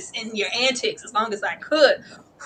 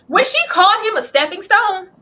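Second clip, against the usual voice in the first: ordinary talk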